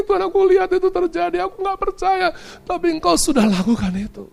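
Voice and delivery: high, wailing confession to God; imitated weeping prayer. Pastor confesses his unbelief to God, acted tearful wailing. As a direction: pray through acted sobs, stretching the words. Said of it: "itu sama nangis, dia ngomong gini".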